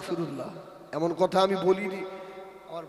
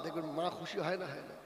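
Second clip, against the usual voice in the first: ordinary talk, nothing marked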